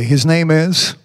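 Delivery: ordinary talk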